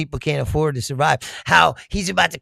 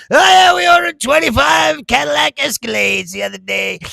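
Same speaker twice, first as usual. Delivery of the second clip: high, loud hammed-up boast; bragging Trump impression; 10 semitones higher, 5 dB louder than usual. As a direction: brag like the mocked president. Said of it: "He's like".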